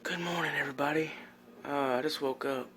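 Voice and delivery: soft early-morning whisper; hushed so nobody wakes. Real, not acted. Early morning, others asleep.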